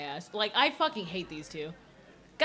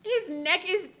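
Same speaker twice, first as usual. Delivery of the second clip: laughing shock; 9 semitones higher, 6 dB louder than usual. Real, not acted. Shocked by a huge man.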